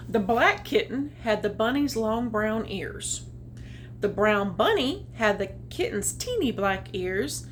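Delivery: plain reading voice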